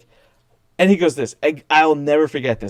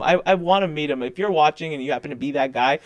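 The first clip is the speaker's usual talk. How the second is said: ordinary talk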